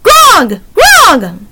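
high, ringing bird cry; two high-pitched imitated raven notes; raven call. A narrator's reading. Raven answers Annie's kindness with high bird calls. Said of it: "Rock made soft, bell-like sound".

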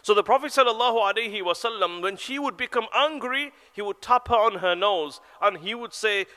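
usual speaking voice

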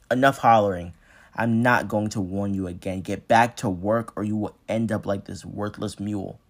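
loud, menacing overseer shout; angry threat. Acted. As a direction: bark the warning at the crowd.

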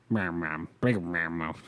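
slow, low, slurred mumble; mumbling. Real, not acted. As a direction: mumble the words out slowly. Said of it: "Jesus, [name], can you fucking say some words?".

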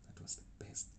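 breathy hushed whisper of delight. Real, not acted. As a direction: whisper it like a treasured memory. Savoring the best chai.

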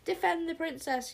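high, loud rallying cry with DEFEND stressed; rally the troops, hitting DEFEND; warriors yell. A narrator's reading. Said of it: "yelled the warriors".

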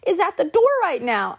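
high, slow startled question; as if jolted by a knock at the door. Retold or imitated. Startled quote of herself at door.

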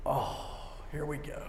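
soft, sinking groan of resignation; dejected dread. Acted. Stressed climber seeing a mountain ahead, dejected and quiet.

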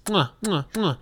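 smack the fingertips, mwah; chef's kiss. Shown as a demonstration. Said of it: "I'm doing the chef kiss".

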